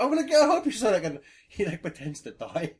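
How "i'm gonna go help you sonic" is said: overacted death scene. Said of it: "And Tails like".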